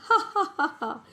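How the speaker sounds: high, spelt-out mock laugh; acted laughter. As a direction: burst of pretend helpless giggling. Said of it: "I can't help laughing".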